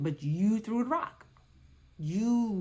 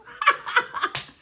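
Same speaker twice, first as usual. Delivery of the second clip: high burst of laughter; 7 dB louder than usual. Real, not acted.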